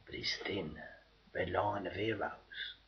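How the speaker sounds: hushed whisper, dropping right down; soft bitter whisper; ironic whisper. Acted. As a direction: whisper the irony. Ironic turn in refrain.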